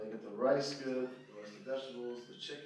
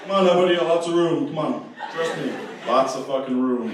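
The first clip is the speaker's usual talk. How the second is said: runway marshal calling out; 2 semitones higher, 17 dB louder than usual. Acted. Comic acts runway worker directing planes by his ears, calling out loudly. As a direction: holler like you're waving everyone in.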